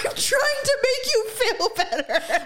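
high, slow, laughing plea; husband's flustered apology, half laughing; pleading husband. Retold or imitated. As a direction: whine it helplessly. Daryl defending himself, retold.

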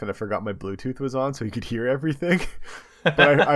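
ordinary delivery

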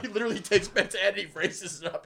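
ordinary delivery

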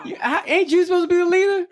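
put-on high, fast, put-upon little girl voice; mock exasperated kid. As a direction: squeal it at dad as a fed-up daughter. Daughter mocking dad, laughing.

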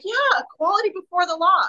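mock earnest praise of equality; sarcastic. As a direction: scoff at the naive idea. Sarcastic echo of the belief.

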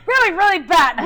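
high, loud "really, really bad"; loud playful repetition. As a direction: repeat it back with exaggerated force. Repeats it again after laughing.

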